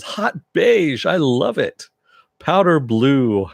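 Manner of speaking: high and loud, savouring each colour with a slow powder blue; gushing Tick impression. Tick swoons over Arthur's accountant outfits, high and gushing.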